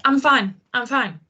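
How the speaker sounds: brushing off concern with a strained, insistent I'm fine; unconvincing I'm fine. Shown as a demonstration. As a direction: say "I'm fine, I'm fine" like you're hiding being upset. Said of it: "from the tone of voice, we know you're not fine".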